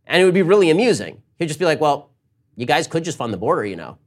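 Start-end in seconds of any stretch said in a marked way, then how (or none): none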